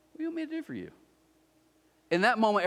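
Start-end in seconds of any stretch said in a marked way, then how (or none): 0.1-0.9 soft, high-pitched gentle voice; hushed, tender offer of help; gentle question. Acted. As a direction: ask it softly and lightly, pitched up. Jesus gently asks the blind man.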